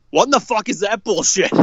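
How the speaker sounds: high mock outraged yell; retold angry yell. Dad opening the door after the bad call, mock outraged yell. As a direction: burst in like a dad who can't believe it. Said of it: "just goes".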